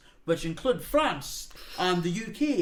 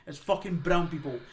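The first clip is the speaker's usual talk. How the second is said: usual speaking voice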